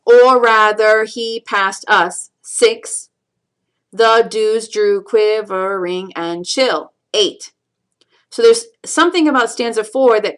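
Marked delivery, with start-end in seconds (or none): 0.1-2.3 slow, each syllable set apart; counting out syllables. Shown as a demonstration. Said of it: "count out the syllables". 3.9-6.9 slow, each syllable set apart; counting out syllables. Shown as a demonstration. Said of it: "count out the syllables".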